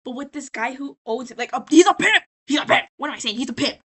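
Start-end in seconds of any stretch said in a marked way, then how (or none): 1.7-2.9 loud, high outburst; sudden emphatic yell; emphatic shout. Real, not acted. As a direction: blurt it out at full volume. Blurts out who he is.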